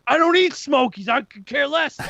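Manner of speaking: mock irate guy, high and loud; heated. Irate stranger in the Smokies line, heated. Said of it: "getting heated".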